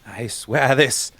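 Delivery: low villain vow. Acted. Villain twin swearing revenge.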